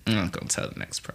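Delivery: very low, near-whispered refusal; hushed aside. Real, not acted. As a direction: drop right down to a voiceless murmur. Backs out of telling the story.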